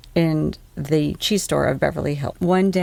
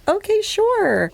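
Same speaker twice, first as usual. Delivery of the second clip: slow, drawn-out, much higher acted reply; high-pitched agreeing; 14 semitones higher, slower than usual. Shopper accepting food samples from him, high drawn-out agreement. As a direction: agree in a mock high, stretched-out voice.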